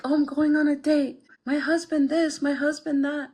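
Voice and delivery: high, bright, gushing parody voice; mock a woman who only talks about her husband; mocking chatty woman. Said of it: "women who are not always like".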